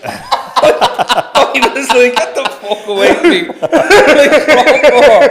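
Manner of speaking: high, loud laughing words; laughing while telling of the rashes. Real, not acted. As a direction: laugh through the line.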